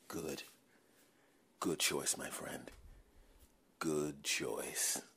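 low, soft, menacing approval; low smug approval. Acted. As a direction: purr it like a satisfied boss.

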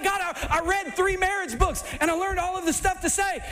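a hammed-up know-it-all plea; mock eager believer. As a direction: act the overeager student. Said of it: "But you're like".

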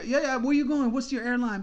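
loud, high, pushy; mock the swarming porters; eager porters.

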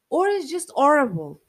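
emphatic. Real, not acted. Emphatic verdict on bad pastry.

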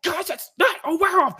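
high-pitched, hammed-up panicked outburst; mock freak-out. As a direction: imitate a man losing it, voice jumping way up. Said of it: "freaking out the whole movie".

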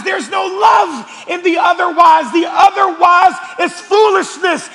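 high, loud repeated pulpit declarations; shouted rhythmic sermon refrain; full-force preaching. Real, not acted. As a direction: hammer each repeated phrase to the congregation.

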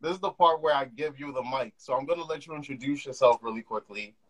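usual speaking voice

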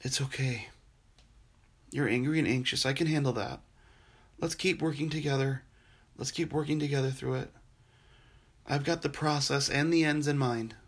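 soft, gentle comfort with long pauses; a hushed, steady voice soothing someone in pain; tender reassurance. Acted. Jesus comforting a suffering believer, softly reassuring.

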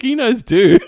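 high, loud, laughing mock reply. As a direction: crack up while quoting yourself. Joking retold reply amid laughter.

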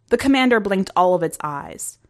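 usual speaking voice

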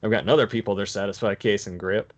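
"other" stressed with a rising end. Real, not acted. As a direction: hit "other" to build the list. Repeated list with shifting stress.

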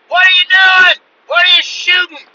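very high, loud shrieked questions; old lady yelling. Retold or imitated. Wife at the house to shooting husband, high yelling. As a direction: holler from the house over gunfire. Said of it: "I hear the old lady at the house".

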